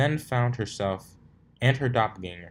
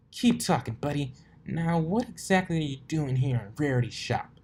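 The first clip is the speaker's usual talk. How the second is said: tough; 7 semitones higher than usual. Acted. Rainbow Dash grilling the colt, higher taunting voice. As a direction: press him like a bully.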